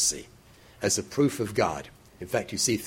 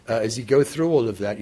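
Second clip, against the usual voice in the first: ordinary talk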